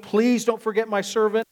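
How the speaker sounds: acted-out urgent request; pleading prayer. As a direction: beg with a push on the plea. Acting out Hannah begging God.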